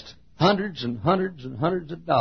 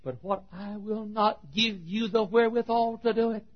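God promising; 10 semitones higher than usual. Acted. God promising to provide, high earnest declaration. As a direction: hold it up high and deliberate. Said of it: "God says to you".

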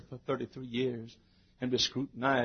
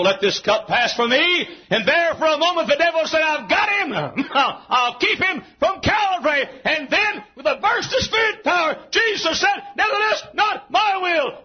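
high, climbing pulpit shout; full-force preaching; 10 dB louder than usual. Real, not acted. Preacher telling of Gethsemane, full-force preaching. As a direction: drive each phrase out high and hard.

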